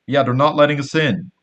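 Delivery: calling out. Retold or imitated. Leans out window calling back.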